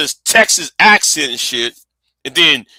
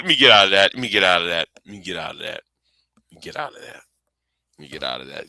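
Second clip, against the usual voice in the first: fading to a whisper; 7 semitones lower, 4 dB quieter, much faster than usual. Real, not acted. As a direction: repeat it softer and lower, trailing into a whisper. Backing away from a touchy topic.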